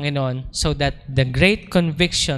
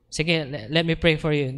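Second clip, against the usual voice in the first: ordinary talk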